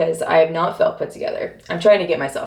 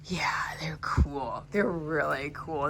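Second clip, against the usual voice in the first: whispering; 11 dB quieter, slower than usual. Real, not acted. Whispering while looking at chairs.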